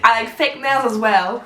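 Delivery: slow, put-on British English accent; drawn-out mock British accent. Shown as a demonstration. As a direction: finish the British accent demo amid laughter. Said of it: "Merci pour cette prestation".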